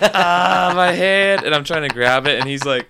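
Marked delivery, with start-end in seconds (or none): 0.0-1.4 high, loud re-enacted wail; hammed-up yelp of pain; mock pained cry. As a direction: cry out as if the hand hurts. Acts out pain in story.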